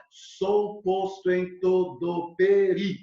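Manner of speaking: slow scansion stopping on the stressed I; counting syllables. Shown as a demonstration. Teacher showing where counting stops, slow syllable-by-syllable scansion. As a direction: count out each syllable, ending on "peri". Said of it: "eu conto até o i".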